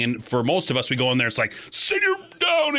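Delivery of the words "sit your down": high, drawn-out mock shout; yelled order. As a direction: act out losing control, yelling a command. Automatic yelling response, contrasted with calm principal.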